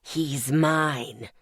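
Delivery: low possessive demon hiss. Acted. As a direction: claim him with a low hiss. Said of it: "the demon hissed".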